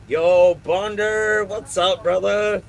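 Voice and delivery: loud, high, drawn-out hype welcome; excited greeting. Real, not acted. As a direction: stretch the greeting out, pumped up. Streamer greets arriving viewer.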